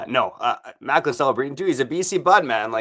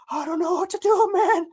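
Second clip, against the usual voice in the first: much higher, whiny put-on voice of a lost guy; mock overwhelmed guy. Ad listener lost with his money, whiny mock voice. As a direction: play the helpless spender, hammed up.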